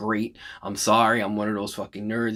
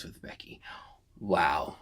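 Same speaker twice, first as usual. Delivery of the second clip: emotional whisper; 5 semitones lower, 8 dB quieter, slower than usual. Real, not acted. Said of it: "I gotta take my glasses off".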